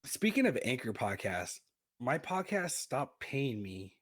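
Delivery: normal talking voice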